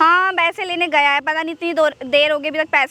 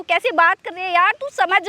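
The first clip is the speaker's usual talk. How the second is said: ordinary delivery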